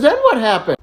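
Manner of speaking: high, insistent questioning; mock urgent demand. Little Johnny pressing the parrot about his wife, urgently. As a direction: demand the next detail, hammed-up urgency.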